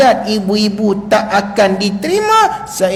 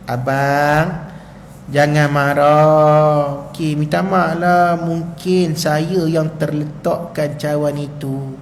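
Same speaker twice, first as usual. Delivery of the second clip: lower, softer, slow soothing; calm him with a tender sorry; gentle apology; 9 semitones lower, 5 dB quieter than usual. Acted. Wife soothing angry husband, soft apology. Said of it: "Pegang tangan suami ni".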